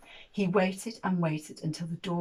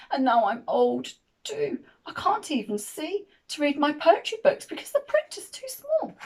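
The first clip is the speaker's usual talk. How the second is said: loud, higher-pitched grumbling giant; complaining old giant; 7 semitones higher, 6 dB louder than usual. Acted. Old giant complaining to Jim about Jack, loud and aggrieved.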